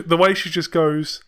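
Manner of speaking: ordinary talk, nothing marked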